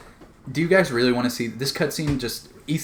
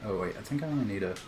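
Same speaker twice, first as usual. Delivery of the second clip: usual speaking voice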